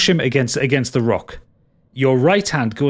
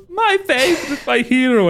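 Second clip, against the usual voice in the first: much higher, breathless hero worship; gushing Oropan; 12 semitones higher than usual. Acted. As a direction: squeal it like an adoring little fan. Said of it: "Oropan goes".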